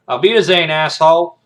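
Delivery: loud, high taunting send-off; mock shouted farewell. Soldier tapping the doomed man's shoulder, mock shouted farewell. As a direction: call out the goodbye with force.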